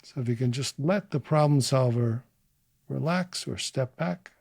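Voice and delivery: guided inquiry. Acted. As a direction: offer each phrase, then leave room to feel it. Leads a short guided inquiry.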